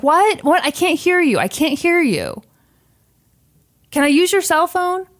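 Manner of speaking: high, insistent imitation of a tearful plea; mock crying. Host imitates the crying stranger begging at the car window, mock tearful. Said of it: "The other woman's crying, crying, crying".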